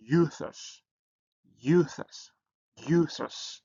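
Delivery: youthus opening with the you of feud; pronunciation demonstration. Shown as a demonstration. Teacher reading the example word, youthus repeated for students to copy. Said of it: "you pronounce it as".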